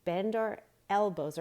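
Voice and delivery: ordinary talk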